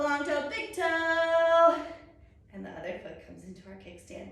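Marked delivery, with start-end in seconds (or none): 0.0-1.8 drawn-out, rising volume on the last toe; playful build-up. Real, not acted. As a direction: stretch it out loud to finish the count.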